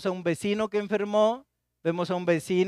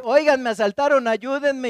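raised, pleading cry for help; mock plea for help, higher and louder; calling for help; 6 semitones higher, 5 dB louder than usual. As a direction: call out to passersby after a robbery.

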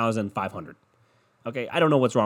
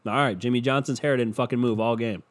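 ordinary delivery

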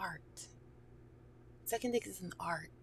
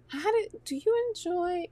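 high mock interviewer; 10 semitones higher, 6 dB louder than usual. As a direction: jump way up high and loud to ask.

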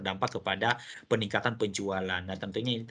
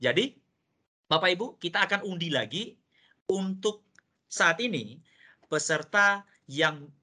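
upbeat, higher-pitched prize call; booming prize announcer; 5 semitones higher than usual. Acted. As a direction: announce it like a game show host. Host announces door prize draw.

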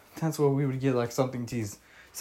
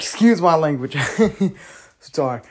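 laughing through a sheepish apology; embarrassed laugh while apologizing; 3 semitones higher, 7 dB louder than usual. Real, not acted. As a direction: giggle out the excuse, a bit louder.

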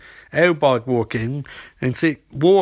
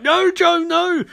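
high, louder cry of protest; desperate mock cry; 4 dB louder, slower than usual. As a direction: plead in an acted, high-pitched panic. Barry desperately tries to stop Joe.

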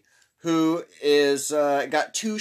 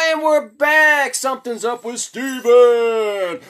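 much higher, louder, slow announcer hype; hyped show intro; 9 semitones higher, 8 dB louder than usual. Acted. Host announcing the show's return, loud hype.

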